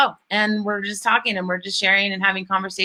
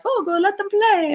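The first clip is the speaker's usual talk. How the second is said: high, airy mock voice waving it off; breezy French wife; 12 semitones higher than usual. French wife tells Wanda to let kids play, airy high mock. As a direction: lightly brush off the worry in a high voice. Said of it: "the French woman's like".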